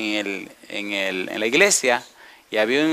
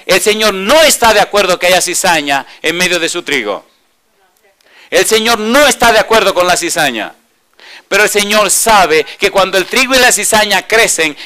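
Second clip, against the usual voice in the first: higher, louder, ringing sermon voice; preaching at full force; 5 semitones higher, 8 dB louder than usual. Acted. Preacher driving home the parable to the congregation, full force. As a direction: drive the parable home to the crowd.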